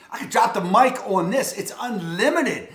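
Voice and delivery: ordinary talk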